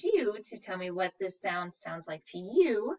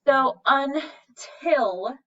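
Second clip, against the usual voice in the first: until stretched slow, rising; slow down and lean on until; drawn out; 6 semitones higher, 6 dB louder, much slower than usual. Shown as a demonstration. Teacher stretching until so students hear the vowel. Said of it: "I hear that eh sound again".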